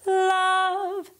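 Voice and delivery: "love" sung high with a bright tongue-tip L. Shown as a demonstration. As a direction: let the L of "love" ring bright. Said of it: "the top teeth here".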